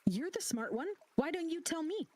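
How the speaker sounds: high, mocking snark; snarky. The NPC mockingly turns the question back on Maddie, snarky. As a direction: throw it back at her. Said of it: "He goes".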